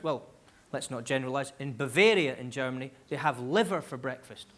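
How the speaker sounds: ordinary talk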